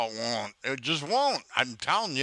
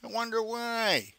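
a light put-on higher voice of the scammer; mocking the scammer; 6 semitones higher than usual. Man voicing the scammer for YouTube, lightly mocking. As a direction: play the dumb thief mockingly.

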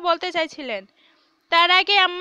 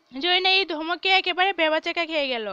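usual speaking voice

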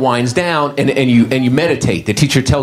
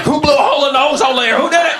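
much higher shouting cow voice; angry cow; 12 semitones higher than usual. Retold or imitated. Cow kicking open the door, demanding answers, much higher shout. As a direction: bellow like a cow bursting in. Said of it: "I'm waiting for a cow to kick open the door, be like".

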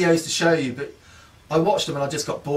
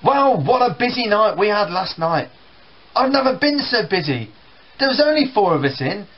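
higher, louder gossiping mum; chatty mum; 6 semitones higher, 3 dB louder than usual. Retold or imitated. Mum telling the family about her night at work, higher. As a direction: go on about the busy shift. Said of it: "she goes on about work".

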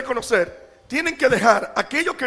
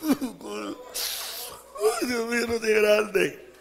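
laughing mock lament; 4 dB quieter, much slower than usual. Pastor mimics his own despair.

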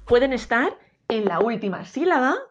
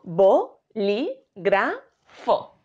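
bo, li, gra, fo split apart; four distinct syllables; 2 semitones lower, much slower than usual. Shown as a demonstration. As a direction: say each syllable separately. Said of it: "Si dividimos las sílabas".

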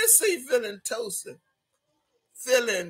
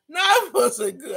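laughing; 5 semitones higher than usual. Real, not acted. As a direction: giggle the quote out. Laughing while reading comment.

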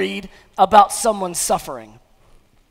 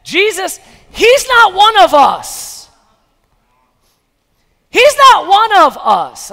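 high, loud, slow protest; indignant crowd; 8 semitones higher, 10 dB louder, slower than usual. Retold or imitated. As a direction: hammer it home like a scandalised crowd. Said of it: "this is what they're really saying".

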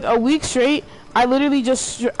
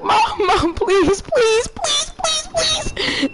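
high, laughing mock plea climbing ever higher; mock screaming for mom; 14 semitones higher, much slower than usual. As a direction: replay a panicked child begging for her mom, half laughing. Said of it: "I'm screaming for my mom".

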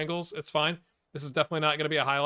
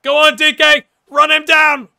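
much higher and louder, full shout; rallying shout; 13 semitones higher, 16 dB louder than usual. Real, not acted. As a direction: roar him on to chase. Shouting encouragement live.

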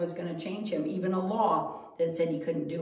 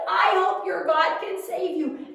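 anxious king; 9 semitones higher, 6 dB louder than usual. Acted.

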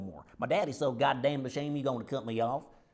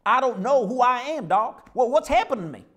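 higher, louder desperate cry for help; 7 semitones higher, 7 dB louder than usual. Acted. Patient begs the doctor for an answer, louder and higher. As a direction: plead with the doctor, near panic.

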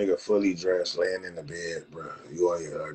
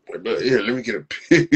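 laughing mockery; 6 dB louder than usual. Mocks fans, laughing.

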